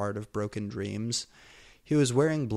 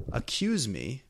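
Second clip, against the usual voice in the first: mild growl; 5 semitones higher than usual. A narrator's reading. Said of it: "I growled".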